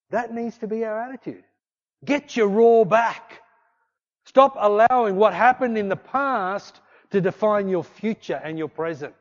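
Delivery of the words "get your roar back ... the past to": loud rallying call. Acted. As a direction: hammer the line home. Preacher exhorting crowd.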